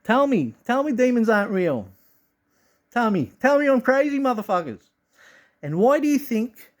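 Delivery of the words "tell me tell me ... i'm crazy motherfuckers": triumphant gloat. Pretend. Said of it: "I can go".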